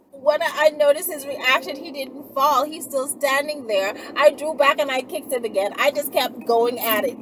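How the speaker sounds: normal talking voice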